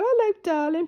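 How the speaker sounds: greeting the rescued pigeon in the morning, cooing; cooing to a pet. Retold or imitated. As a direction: coo it like greeting a beloved pet.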